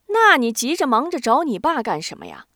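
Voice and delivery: 提高调门和音量追问; 抓住破绽紧逼着问; 进一步逼问. A narrator's reading. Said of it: "进一步逼问". (高声逼问)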